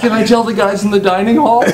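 eager laughing question. Retold or imitated.

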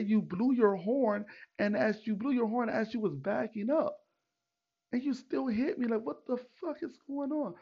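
increasingly high-pitched indignation; exasperated, high-pitched retold rant; retold going off. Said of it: "like, going off on this guy".

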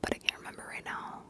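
very soft, voiceless whispering; faint whisper. Real, not acted. Vlogger mulling over small channels, in a faint whisper. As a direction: drop right down to a barely-there whisper.

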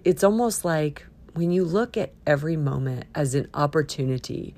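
ordinary talk, nothing marked

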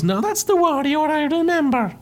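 high smug cartoon Mario; Mario's voice. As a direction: say it pleased, up high. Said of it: "And he goes".

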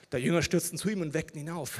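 normal talking voice

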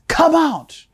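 a sudden high cry of come out; pleading call. Real, not acted. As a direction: plead with the crowd to leave.

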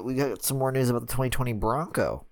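ordinary delivery

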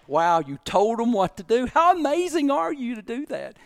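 high, gushing mock amazement; sarcastic praise. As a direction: imitate a boss mockingly applauding a failure. Boss claps, mockingly praising his excuse.

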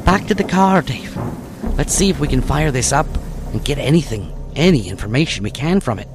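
detective giving orders. Acted. Detective Kate's voice.

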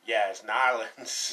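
put-on lightly slowed Louisiana local accent; Southern drawl. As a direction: mimic the old timer's Nolans. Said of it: "some old timer on the car ferry said".

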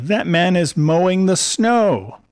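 put-on child's voice. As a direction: play a puzzled small boy. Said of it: "Toby, ... said".

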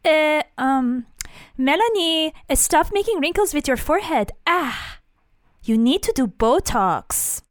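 high, caricatured nagging mom voice; hammed-up mother scolding her daughter; mom impression. Mom nags daughter about Botox, high caricature voice. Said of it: "I have a mom who sounds like this".

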